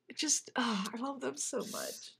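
very soft, nearly whispered gush; hushed adoration. Real, not acted. Fan overcome by a beloved fictional couple, hushed adoration. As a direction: let the fondness sink to a near-whisper.

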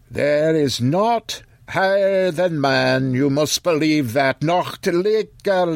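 put-on high, slow, earnest old voice; old man Occam. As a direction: plead with him to believe.

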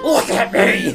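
high, loud smug dad; proud dad voice. Retold or imitated. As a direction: preen at the mirror. Dad voiced in mirror bit.

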